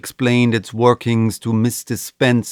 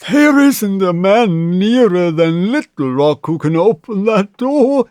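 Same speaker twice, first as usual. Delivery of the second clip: put-on higher, loud, shaken old banker; shaky voice; 8 semitones higher, 5 dB louder than usual. A narrator's reading. Banker telling the mother nobody can open the door. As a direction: speak like a frightened old man. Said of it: "in a shaky voice".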